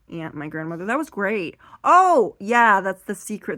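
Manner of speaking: normal talking voice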